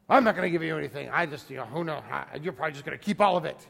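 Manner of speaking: put-on quick, grumbling old man; grumpy old man. Grumpy neighbor accusing the boy of keeping money, quick grumbling. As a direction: brush the kid off like a suspicious old grump.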